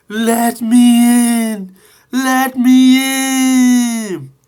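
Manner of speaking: very high, loud, slow wailing ghost; spooky voice. Acted. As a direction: wail it out like a phantom. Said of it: "said Percy with a spooky voice".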